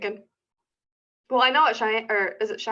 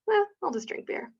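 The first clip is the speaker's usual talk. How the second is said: careless shrug; 11 semitones higher, 3 dB quieter than usual. Retold or imitated. Acts out her own shrug.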